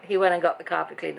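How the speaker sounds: ordinary delivery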